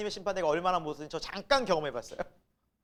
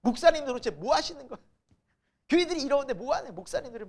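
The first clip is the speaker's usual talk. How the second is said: raised, louder indignant grumbling; mock angry complaint; 7 semitones higher, 5 dB louder than usual. Preacher mimicking members angry at pastors, mock exasperation. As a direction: act out an exasperated churchgoer. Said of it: "그렇게 분노하시지는".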